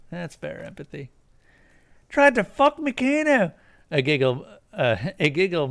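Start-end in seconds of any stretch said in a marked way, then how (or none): 2.1-3.5 much higher, louder sneer; high mocking voice. Kuno S gloating at Kuno, high and mocking. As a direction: taunt him gleefully.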